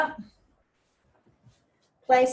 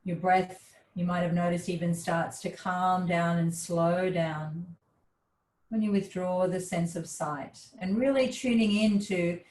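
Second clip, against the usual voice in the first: guided relaxation; 2 semitones lower, 3 dB quieter than usual. Real, not acted. Yoga teacher guides class with eyes closed, soft guided relaxation. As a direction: ease them inward, calm and unhurried.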